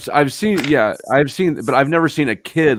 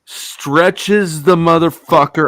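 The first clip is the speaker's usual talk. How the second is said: slow, drawn-out, louder; drawn-out disgusted telling; 5 semitones higher, 4 dB louder, much slower than usual. Real, not acted. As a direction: stretch the words out for effect. Acting out the gross guy.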